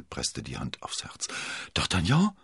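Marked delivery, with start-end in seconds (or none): none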